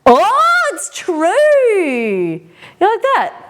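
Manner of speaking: loud, very high squeal; excited high-pitched cry; thrilled discovery. Real, not acted. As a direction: burst out high and loud with delight. Sudden delighted discovery.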